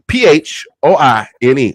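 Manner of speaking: ordinary talk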